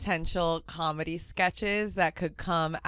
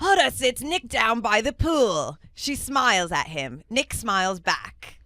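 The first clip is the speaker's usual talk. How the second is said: amateur Scottish narrator voice, pitched up; [name]'s Scottish accent; 5 semitones higher, 4 dB louder than usual. Acted. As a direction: announce it with an attempted Scottish accent. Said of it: "I gotta get my Scottish accent going".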